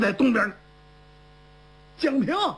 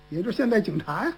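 ordinary delivery, nothing marked

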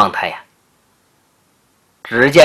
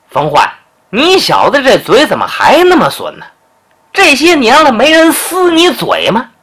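mild mock annoyance; 7 semitones higher than usual. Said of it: "长脖鹿一听不干了".

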